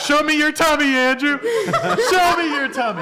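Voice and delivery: playful teasing. Pretend. Teasing Andrew during lint rolling.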